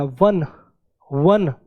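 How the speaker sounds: one with a clear w sound; वन spoken as "wun", opening on w; w sound demo. Shown as a demonstration. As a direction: say वन starting on a w. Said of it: "अब यहाँ पे आप देख रहे हो भले ही ओ लिखा हुआ, लेकिन साउंड कैसी है".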